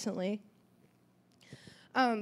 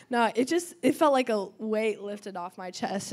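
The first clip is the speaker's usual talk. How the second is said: ordinary talk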